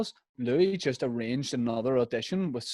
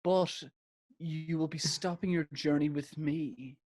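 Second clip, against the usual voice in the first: mock-solemn, with a long pause after the first word; Louis Walsh; 3 semitones higher, 4 dB quieter than usual. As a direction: lightly imitate a judge milking the suspense. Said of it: "And Louis goes".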